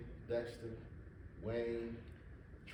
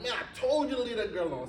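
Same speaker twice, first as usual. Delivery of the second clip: a higher, louder scolding outburst, told as a memory; exasperated scolding; 9 semitones higher, 7 dB louder than usual. Retold or imitated. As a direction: raise your voice and pitch, retelling a boss who is fed up with you. Freddie scolding, throws hot dog.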